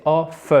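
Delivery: "off" with the final F released separately; double-F off. Shown as a demonstration. Teacher explains the two Fs, "off" with a drawn-out F. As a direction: exaggerate the f at the end of "off". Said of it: "Il y a deux F là".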